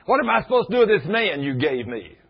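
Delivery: high, loud exasperated cry; mock the wife venting at God, full tilt; hammed-up exasperated wail; fussing wife. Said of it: "she's fussing and at me and at God".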